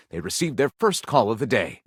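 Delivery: usual speaking voice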